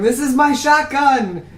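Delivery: high, loud, drawn-out yell with laughter in it. Acted. Shopkeeper running out with his shotgun, yelling. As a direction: holler it slowly like a crazed townsman. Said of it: "he just keeps yelling".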